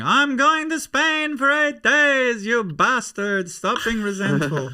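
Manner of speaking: high, slow, far-off mock voice of a gloating Hannah; a friend gloating about her holiday, mimicked high and slow. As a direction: stretch it out high and smug, teasing.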